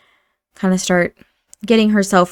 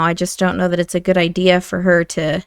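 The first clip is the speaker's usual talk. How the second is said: ordinary talk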